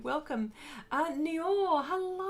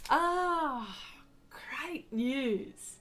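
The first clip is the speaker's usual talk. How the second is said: whisper; 3 semitones higher, much slower than usual. Real, not acted. Whispered reaction to chat news.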